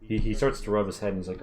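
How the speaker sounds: ordinary talk, nothing marked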